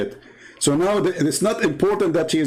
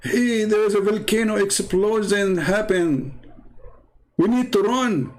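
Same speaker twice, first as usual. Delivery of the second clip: urgent warning; 7 semitones higher than usual. Retold or imitated. Friend warning of a volcano, higher and urgent. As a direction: warn them to run from the volcano. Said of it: "Like somebody telling you".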